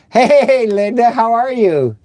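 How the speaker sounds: happy hello to the neighbor; cheery greeting. Real, not acted. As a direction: greet her like a welcome surprise. Greeting viewer in chat.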